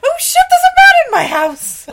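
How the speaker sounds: high, loud mock-panicked yell; startled shriek. Woman finds a man in her house, shrieking. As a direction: shriek it in comic alarm.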